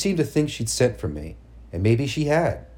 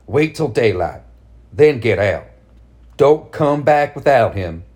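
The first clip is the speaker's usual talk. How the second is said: cold command; 5 dB louder than usual. Acted. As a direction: order her out coldly. Mother orders daughter away.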